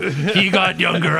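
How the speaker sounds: ordinary talk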